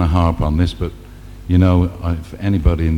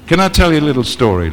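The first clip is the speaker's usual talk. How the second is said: teasing; 7 semitones higher, faster than usual. Acted. Comic teases audience, high fast.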